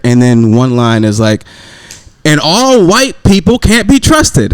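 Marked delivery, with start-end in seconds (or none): 2.2-4.5 high, slow mimicked proclamation; mock street preacher. Man retelling what street preachers shout, mock proclamation.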